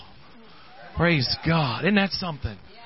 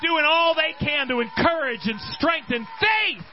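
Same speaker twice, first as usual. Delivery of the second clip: rhythmic repeats rising to a high cry; building fervent preaching; 5 semitones higher than usual. Real, not acted. Preacher rallying church about praying for somebody, preaching that builds to a shout. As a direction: preach in waves, climbing to a shouted peak.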